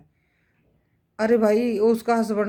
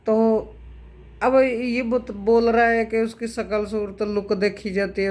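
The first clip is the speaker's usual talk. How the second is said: normal talking voice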